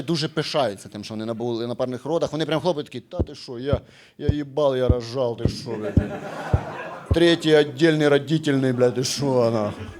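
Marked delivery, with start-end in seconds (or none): 3.0-9.9 mock bragging guy. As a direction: play a swaggering dad. Гордий чоловік хвалиться пологами. (хвалькуватий чоловік, нижче)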